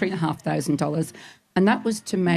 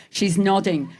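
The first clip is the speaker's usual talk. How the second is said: ordinary delivery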